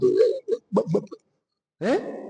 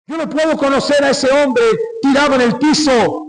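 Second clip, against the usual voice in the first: higher, pleading cry of denial; anguished denial; 6 semitones higher than usual. Retold or imitated. Peter disowning the beaten Jesus, in a raised anguished cry. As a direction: Peter protesting he can't know this broken man.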